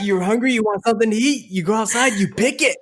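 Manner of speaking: high-pitched put-on scolding; mock parental orders. Joking about picking sauce off the vine, high mock orders.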